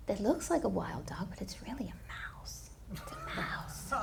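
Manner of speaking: hushed gossiping whisper. Acted. Disciples gossiping about the wild dog, whispered. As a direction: whisper it like a secret behind his back.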